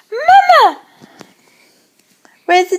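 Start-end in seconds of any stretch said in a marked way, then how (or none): 0.1-0.8 put-on "Mama" sung high and falling for a baby to copy; modelling a word. Shown as a demonstration. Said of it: "Can you say".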